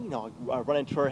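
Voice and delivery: ordinary delivery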